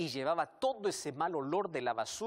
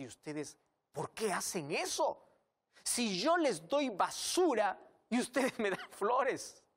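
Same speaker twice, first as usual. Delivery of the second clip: vecino asombrado; 7 semitones higher, 4 dB louder, slower than usual. Acted. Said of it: "abrió los ojos bien grandes".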